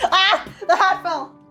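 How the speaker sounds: usual speaking voice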